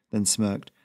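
usual speaking voice

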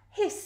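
short high goose hiss. A narrator's reading. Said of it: "said the goose".

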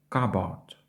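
"cupboard" said KAH-bord, no P; silent P. Shown as a demonstration. Teacher models the kitchen word, silent P. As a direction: say "cupboard" going straight to B. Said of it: "буква P не вимовляється".

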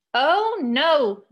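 storybook yell, then drop back; yelled exclamation. A narrator's reading. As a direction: call it out with big emotion. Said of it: "Jean yelled".

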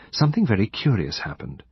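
ordinary delivery

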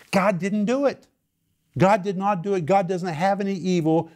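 high-pitched, insistent protest; emphatic denial. Real, not acted. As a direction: pitch jumps up in firm insistence. Preacher forcefully denies God allowed tragedy.

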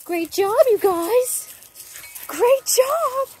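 high, loud praise voice; dog praise. Real, not acted. Trainer praising both dogs in a high, loud praise voice.

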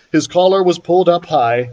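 ordinary delivery

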